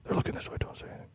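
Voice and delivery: scared hushed whisper. Retold or imitated. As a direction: whisper it so the clerk can't hear. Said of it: "you were immediately like".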